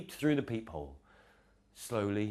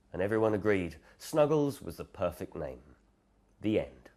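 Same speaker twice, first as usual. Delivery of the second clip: plain reading voice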